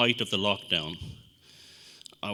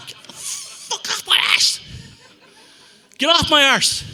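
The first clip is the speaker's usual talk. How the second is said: much higher and louder screaming yell; losing it at the little guide; furious shout; 7 dB louder than usual. Retold or imitated. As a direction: scream it in total panic. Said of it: "And I just lost it".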